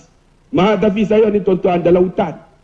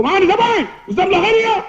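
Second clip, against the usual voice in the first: high-pitched, irritated phone manner; brusque principal; 10 semitones higher, faster than usual. Acted. Principal answers the ustaz's phone call, high brusque voice. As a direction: put on a cross, piercingly high questioning. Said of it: "Dia cakap memang macam tu".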